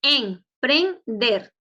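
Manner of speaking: Emprender split into em-pren-der, slow and loud; syllable by syllable. Shown as a demonstration. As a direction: break Emprender into three drawn-out syllables. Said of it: "Tres sílabas".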